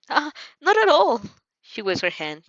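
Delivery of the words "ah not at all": put-on high, flustered girl's voice. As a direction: brush it off, all flustered. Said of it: "clearly flustered".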